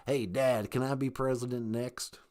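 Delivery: put-on sneaky, low frat boy with a snicker; hushed, deep, slightly evil son; George W. Bush. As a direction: speak low and soft, slyly misbehaving. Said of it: "he was a little more evil and a little sneakier".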